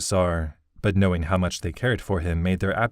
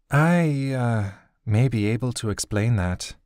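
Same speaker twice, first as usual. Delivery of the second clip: put-on higher, awkward young woman's voice; Naomi, hesitant; 4 semitones higher than usual. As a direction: hesitantly break into the talk. Naomi's new voice.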